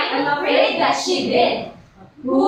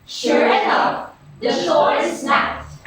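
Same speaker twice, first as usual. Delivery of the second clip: usual speaking voice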